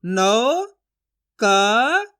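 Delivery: नौ and क each held long, rising; sounding out syllables. Shown as a demonstration. Teacher sounding out a word letter by letter, drawn out. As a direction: stretch each syllable apart, with force on क. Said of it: "इसी तरीके से आपको उच्चारण करना है".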